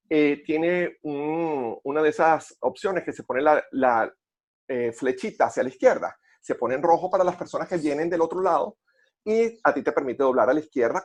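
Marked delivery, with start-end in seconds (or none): none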